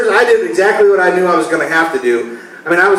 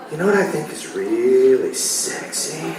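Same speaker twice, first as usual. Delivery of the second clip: sultry wife; 4 semitones lower, 7 dB quieter, slower than usual. Retold or imitated. Wife's seductive line in bit.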